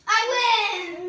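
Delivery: loud triumphant cheer; excited. Real, not acted. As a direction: crow about winning. Winning the challenge.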